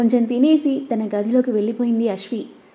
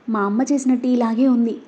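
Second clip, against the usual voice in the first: plain reading voice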